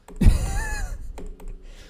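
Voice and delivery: high-pitched amused laugh; high giggle. Real, not acted. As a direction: laugh high at your own joke. Laughs at own tease.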